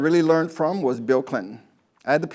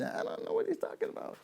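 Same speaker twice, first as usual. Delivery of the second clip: very high, soft squeaky puzzled voice; mock audience; 10 dB quieter than usual. The coach mimics a clueless listener, squeaky and high. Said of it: "you say".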